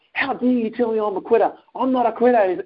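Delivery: high-pitched, offended retort; retold indignation, pitch way up; indignant outburst. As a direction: act out an outraged comeback. Acted angry comeback to brother.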